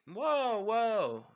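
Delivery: loud, high, drawn-out exclamation; acted high-pitched alarm; startled protest. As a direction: cry out to halt an attack. Acted startled reaction to challenge.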